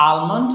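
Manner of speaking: আলমন্ড said with a sounded L; common mispronunciation. Shown as a demonstration. Teacher lists foods as commonly misread, common mispronunciation of আলমন্ড. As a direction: say আলমন্ড as spelt, L included. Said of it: "আসলে সবগুলো উচ্চারণ ভুল".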